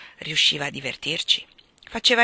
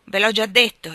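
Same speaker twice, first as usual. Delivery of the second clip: put-on loud, higher drunken shout; 3 semitones higher, 8 dB louder than usual. A narrator's reading. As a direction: yell it back at him, unsteady on his feet. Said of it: "gli gridò alzandosi".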